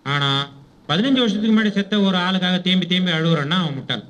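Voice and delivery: ordinary delivery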